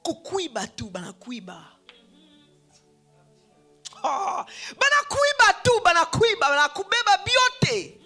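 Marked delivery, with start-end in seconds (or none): none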